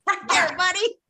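sing-song scolding of a dog; high dog-talk voice. Retold or imitated. Echoing the dog command.

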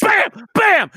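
high, punched sound-effect words; acted-out impact, exclaimed; mock violent slam. Acts out slamming into the tub.

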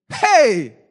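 sudden loud cry; preacher's exclamation. Real, not acted. Preacher amazed at ninety blameless years, exclaiming loudly. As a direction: burst out at full volume.

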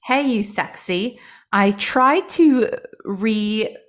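slow, loud mock-British read-out; poor British accent. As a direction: put on an exaggerated British accent, drawing it out. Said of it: "go with the British accent today, the poor British accent".

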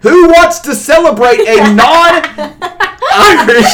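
loud, high, drawn-out outraged shout; heated rant. Real, not acted. Co-host ranting about Saint Patrick's Day, heated shouting. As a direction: blow up over a stupid holiday, shouting high. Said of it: "you getting heated".

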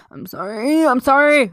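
high, loud playful wail; mock apology. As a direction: plead forgiveness, building to a mock shout. Mock apology to offended friend.

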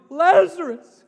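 loud, high, anguished call; mourning cry. Acted. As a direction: cry the name out in grief, full voice. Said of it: "he cried out in mourning".

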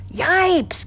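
high, loud yelp of alarm; startled gasp. A narrator's reading. Jerome as a frog jumps at him, gasping. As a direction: jump with a shrieked gasp. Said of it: "gasped Jerome".

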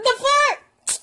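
high-pitched squeak of disbelief; comic high-voiced bafflement; incredulous. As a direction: squeal it in mock outrage. Acted disbelief at the meal.

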